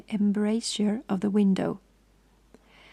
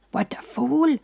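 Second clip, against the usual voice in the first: roar; 4 semitones higher, 3 dB louder than usual. A narrator's reading. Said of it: "roared the big Italian".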